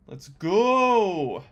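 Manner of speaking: drawn-out, triumphant whoop on "go"; real excited cheer. Real, not acted. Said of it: "Sorry, I'm very excited".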